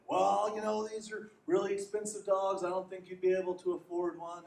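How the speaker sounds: lightly voiced farmer turning him down; mildly dismissive farmer. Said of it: "he's like".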